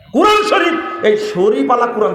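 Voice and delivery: indignant outburst. Real, not acted. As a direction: exclaim it in indignation. Indignant at the printed title. (ক্ষুব্ধ চড়া গলা)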